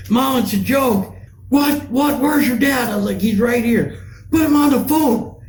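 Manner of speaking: hammed-up frantic mom demanding dad; freaking-out mom. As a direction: shriek it like an alarmed mother. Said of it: "she's freaking out".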